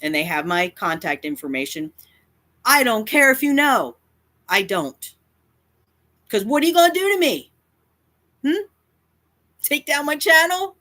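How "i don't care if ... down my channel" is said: loud, high, heated defiance; defiant challenge. Real, not acted. Content creator daring a rival to do their worst, loud and defiant. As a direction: throw it out as an indignant challenge.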